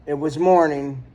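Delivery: plain reading voice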